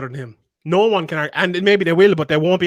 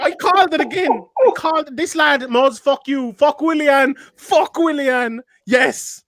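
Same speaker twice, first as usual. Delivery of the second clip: elated; 8 semitones higher, slower than usual. Real, not acted. Elated after goal.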